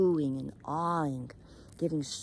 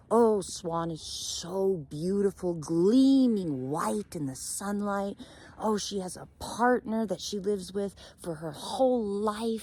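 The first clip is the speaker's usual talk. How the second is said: drawn-out, higher gushing; admiring longing; 4 semitones higher than usual. Acted. Raven dreams aloud of being swan, gushing with longing. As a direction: swoon slowly over every word of praise.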